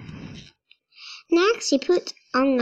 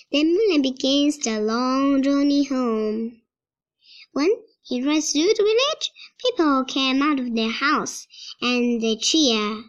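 usual speaking voice

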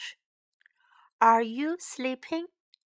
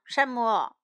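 plain reading voice

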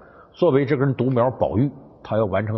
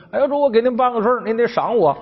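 fawning servant; 10 semitones higher than usual. Retold or imitated. Servant flatters master for reward.

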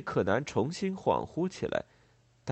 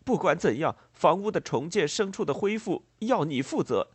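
higher-pitched, agitated farmer voice; demanding farmer; 5 semitones higher, 4 dB louder than usual. A narrator's reading. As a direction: press the demand like a distraught farmer. Distraught farmer demands compensation. (激动地要求赔偿的农人)

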